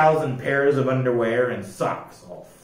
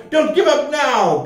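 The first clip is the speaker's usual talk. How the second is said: higher shouted plea; screaming plea; 10 semitones higher, 4 dB louder than usual. A narrator's reading. Joe screaming to his crew not to quit, shouted. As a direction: beg them to fight on. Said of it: "screamed Joe".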